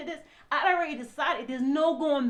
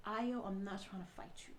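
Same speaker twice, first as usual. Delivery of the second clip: low, soft, backing away from a fight; quiet de-escalation; 5 semitones lower, 14 dB quieter than usual. Retold or imitated. Wife trying not to fight her husband, quiet and low. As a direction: say it hushed and low. Retells own last calm words to husband.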